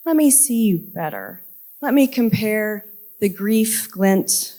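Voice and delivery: slow, measured poem recital with deliberate pauses; poetry reading. Acted. Poet reading Alva's desert breakup to a festival audience, measured recital. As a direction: read it line by line, leaning on key words.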